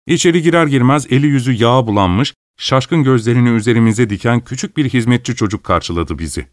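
ordinary delivery, nothing marked

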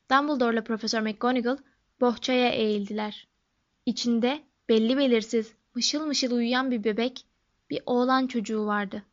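usual speaking voice

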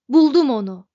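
bang the console and roar it out; triumphant shout. A narrator's reading. Zaphod shouts, fist on console. (zaferle bağırarak)

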